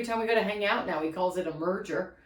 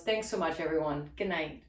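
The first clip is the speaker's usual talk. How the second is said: usual speaking voice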